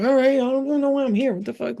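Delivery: high, baffled demon voice; confused demon. Retold or imitated. Demon backing off from the dreamer, high and baffled. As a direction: play the demon lost and puzzled.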